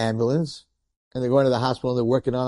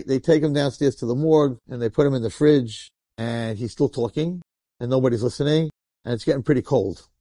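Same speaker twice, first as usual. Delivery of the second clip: ordinary talk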